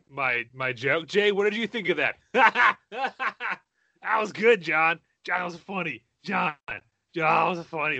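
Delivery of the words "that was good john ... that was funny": high, laughing praise. Real, not acted. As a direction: giggle through the words. Laughing at co-host's joke.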